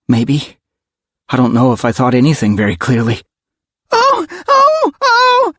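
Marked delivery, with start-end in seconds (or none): 3.9-5.5 very high, repeated wail. A narrator's reading. Karen wails under the crouching leopard, wailing. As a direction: wail like a frightened child. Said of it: "Karen wailed".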